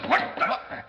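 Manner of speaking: sudden high startled cry; startled yelp in the dark. Acted. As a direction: jump as something brushes your leg. Something brushes the officer's leg.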